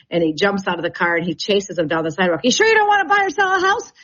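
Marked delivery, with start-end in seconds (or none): 2.4-3.9 high, loud, fast desperate pitch; hammed-up pushy realtor calling after a fleeing buyer; eager pushy salesman. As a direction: chase him down with the sales question. Agent chasing buyer down sidewalk.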